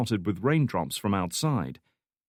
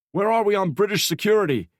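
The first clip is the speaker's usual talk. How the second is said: much higher, loud call across the room; 12 semitones higher, 6 dB louder than usual. A narrator's reading. Section chief calling across the noisy room, loud. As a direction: call out over the busy office. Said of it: "he called out".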